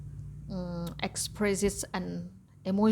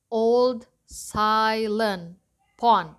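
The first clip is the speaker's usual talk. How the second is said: put-on slow, each syllable of "old silent pond" spaced out and louder; 5 dB louder, much slower than usual. Shown as a demonstration. Teacher to class, counting syllables slowly. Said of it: "It mean that's five syllables".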